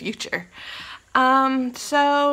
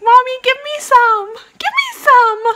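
much higher, louder begging dog voice; 14 semitones higher, 9 dB louder than usual. Retold or imitated. Vlogger voicing her dog begging her for treats, high and loud. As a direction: beg like a pleading puppy. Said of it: "going like".

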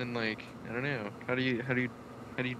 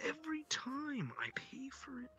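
whisper; 13 semitones higher, 5 dB quieter, slower than usual. Acted. Ranting about the Slurpee cup.

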